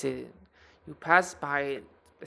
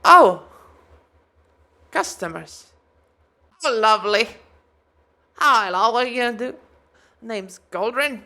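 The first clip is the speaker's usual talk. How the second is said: loud, high, bubbly dwarf greeting; cheery dwarf smith; 6 semitones higher, 11 dB louder than usual. Acted. GM as dwarf smith greeting customers, loud and high. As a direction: burst out welcoming customers, much higher and louder. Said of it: "She pulls up her visor, it's like".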